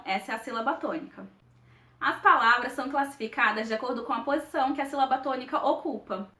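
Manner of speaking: ordinary talk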